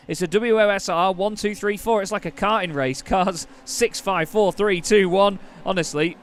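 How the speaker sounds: loud, excited race call; motorsport commentator. Acted. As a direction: call the race start at full pitch. Live race play-by-play at start.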